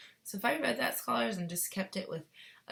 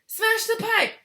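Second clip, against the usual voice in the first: high, loud yell; 12 dB louder, slower than usual. A narrator's reading. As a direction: yell the order. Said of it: "yelled Missus Gloop".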